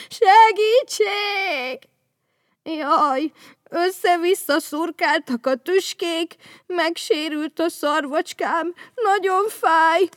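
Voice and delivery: high, loud, slow wailing voice; crying. Acted. Vendel stuck in thorny bush, slow high wailing cry. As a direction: wail out the pain. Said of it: "sírt Vendel".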